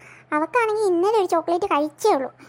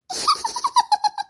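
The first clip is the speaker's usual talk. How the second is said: a sudden, much higher burst of laughter. Acted. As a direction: cackle at the kid's trouble. Mother laughs at scolding.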